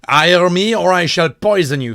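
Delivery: assassin threat. Acted.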